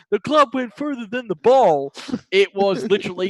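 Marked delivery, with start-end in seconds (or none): 0.1-1.9 slow, higher movie-line delivery; quoting Happy Gilmore. Retold or imitated. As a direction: recite it like the famous film line. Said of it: "You know, Happy Gilmore".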